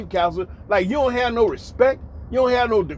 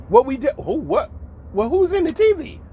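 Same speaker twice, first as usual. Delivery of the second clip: high-pitched baffled questions; acted bewilderment; 8 semitones higher than usual. Man puzzled by who is on the TV, acting bewildered. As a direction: act thrown, firing off high questions. Acts confusion at voice from TV.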